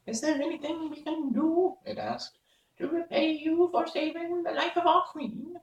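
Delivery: mouse voice. Acted. A mouse asks the Woodman how to repay him, high squeak. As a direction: ask it like a small helpful mouse.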